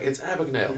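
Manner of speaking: "Abagnale" said as "AB-ig-nail"; correct pronunciation. Retold or imitated. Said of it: "No, he's like".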